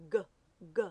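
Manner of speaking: isolated "g" as in gate, repeated; "g, g" as two separate hard G sounds. Shown as a demonstration. Teacher showing the hard sound of G, "g" as in goat. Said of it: "The hard sound of G is".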